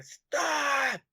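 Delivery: high-pitched mock shout, drawn out; retold yell, pitch jumping way up; shouted order. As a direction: imitate a guard yelling a sudden command. Retelling a guard's sudden order.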